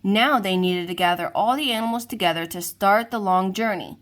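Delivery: plain reading voice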